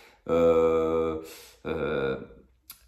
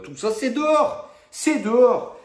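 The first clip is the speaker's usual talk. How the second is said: much higher, louder ranting verdict; escalating comedy rant; 4 dB louder than usual. Real, not acted. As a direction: rant it out, rising to a shout. Montée de la colère du fan. (coup de gueule qui monte)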